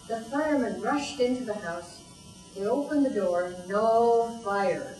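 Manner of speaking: usual speaking voice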